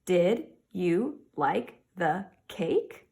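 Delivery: very slow, rising end on cake; slow model question with a final rise; slow question reading. Shown as a demonstration. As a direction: read it slowly with a lifting end. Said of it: "Am I asking a question".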